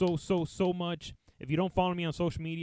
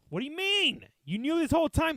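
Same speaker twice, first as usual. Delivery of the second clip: much higher, louder outburst; mock outraged shout; 12 semitones higher, 7 dB louder than usual. Yelling at the old man who knew his address, mock outrage. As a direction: yell it in disbelief.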